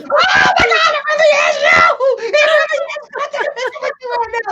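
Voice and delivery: piercing falsetto fangirl impression; screaming fan. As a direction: squeal in mock hysteria, speeding up on the request. Fan reacting to the voice, acted.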